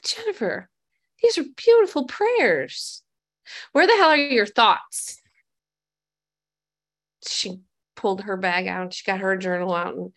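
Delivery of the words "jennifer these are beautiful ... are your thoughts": sponsor's mock praise. Sponsor teasing about her journal.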